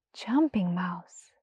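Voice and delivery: soft whispered, slow name; the mouse whispering his new name; awed whisper. Acted. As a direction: breathe the name in wonder.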